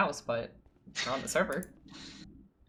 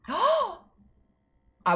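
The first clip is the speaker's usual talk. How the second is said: gasping, high and stretched out; excited gasp; 6 dB louder than usual. Real, not acted.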